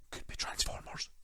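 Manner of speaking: soft whispered rumour; secret whisper. Pretend. Teasing a rumoured download, a hushed whisper. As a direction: whisper it like you're eavesdropping. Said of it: "if I put my ear up against the wall".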